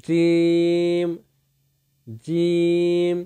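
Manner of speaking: জিম with mid-tongue on the palate, vowel stretched; very slow letter-drill জিম; demonstrated letter sound. Shown as a demonstration. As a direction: hold জিম long on one level note. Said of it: "উপরস্থ তালুর সঙ্গে লাগিয়ে".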